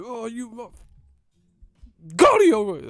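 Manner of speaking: high, loud angry yell; mom yelling. Retold or imitated. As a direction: bark the order at the kid. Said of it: "My mom be like".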